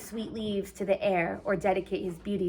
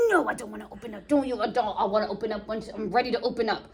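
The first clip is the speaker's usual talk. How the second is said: sulky refusal; 2 semitones lower, 4 dB quieter, faster than usual. Retold or imitated. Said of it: "And Romeo's like".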